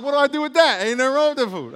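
high, loud exasperated protest; mock baffled God. God reacting to a pointless food prayer, exasperated. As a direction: protest in comic disbelief.

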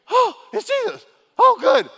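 gasp, then a very high, loud cry of relief; relieved gasp. Acted. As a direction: burst out in relief, the fear gone. Disciples relieved it is Jesus.

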